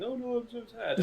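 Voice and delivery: high, whiny fake sorrow; mock the mourners, high and whiny; mock sad voice. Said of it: "lots of people going".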